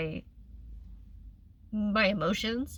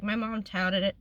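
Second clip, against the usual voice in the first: ordinary talk, nothing marked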